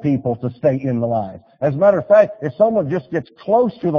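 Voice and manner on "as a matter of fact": ordinary delivery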